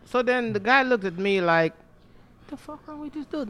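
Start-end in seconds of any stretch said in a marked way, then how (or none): 1.7-3.5 hushed, high, slow disbelief; very soft, drawn-out, higher-pitched incredulity; mock bewildered thought. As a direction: imitate a stunned customer thinking under his breath. Replays the customer's bewildered look.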